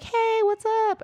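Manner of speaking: very high, breezy greeting; casual Bilbo. Retold or imitated. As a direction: toss off a cheery hello as Bilbo. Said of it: "And Bilbo's like".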